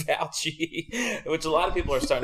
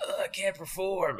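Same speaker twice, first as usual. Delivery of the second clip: softer, higher sore-throat complaint; 6 semitones higher, 8 dB quieter than usual. Acted. Singer in a Ricola commercial, mock sore throat. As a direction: groan it weakly, as if the throat hurts. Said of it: "a guy has a sore throat".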